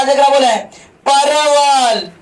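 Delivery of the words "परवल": mock dictation, word stretched right out; long drawn-out word. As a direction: hold the vowels very long. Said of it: "ऐसे बोले". (शब्द को बहुत खींचकर)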